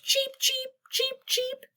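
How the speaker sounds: very high little cheeps; chirping sparrows. A narrator's reading. Reader voicing sparrows that keep owl awake, chirping. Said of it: "The sparrows chirped".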